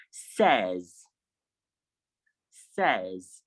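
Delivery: says as SEZ; short E in says; correct pronunciation. Shown as a demonstration. Teacher modelling the word for students, says as SEZ. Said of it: "S A Y S is pronounced".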